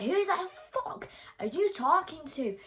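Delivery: shouting high and loud at him; re-enacting a furious telling-off; furious rant. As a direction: tear into him, raging. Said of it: "I was going mental".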